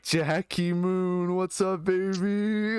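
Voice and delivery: high, drawn-out mock call; imitated hype greeting. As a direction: stretch out a hailing call, imitating excited fans. Retold greeting called out by game guys.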